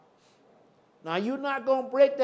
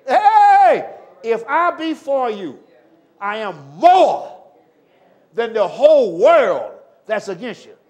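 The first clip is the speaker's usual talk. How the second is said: booming pulpit proclamation; proclaiming shout; 13 dB louder, slower than usual. Acted. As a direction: call it out with full power. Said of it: "It was Jesus that said it".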